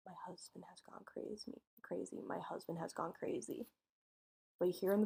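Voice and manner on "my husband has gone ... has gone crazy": soft whispered plea, barely voiced; frightened whisper. Wife whispering to police on phone, hushed and scared. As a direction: whisper it like she's hiding. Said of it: "She's going".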